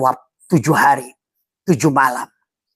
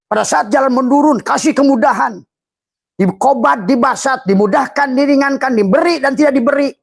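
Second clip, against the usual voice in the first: preaching at full force; 9 semitones higher than usual. Real, not acted. Preacher raising his voice to the crowd. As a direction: rouse the congregation. Preacher rises to fervent pitch.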